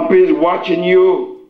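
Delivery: high, emphatic pulpit warning; preaching. Real, not acted. Preaches to congregation.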